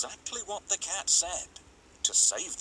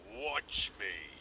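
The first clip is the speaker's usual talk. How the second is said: ogre; 3 semitones lower than usual. A narrator's reading. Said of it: "said the ogre".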